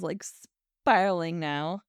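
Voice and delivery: usual speaking voice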